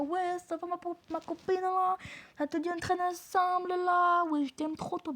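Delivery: mock her overfriendly approach in a high-pitched voice; mimicked fake-friendly classmate. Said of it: "tu viens me parler". (voix aiguë moqueuse de fausse copine)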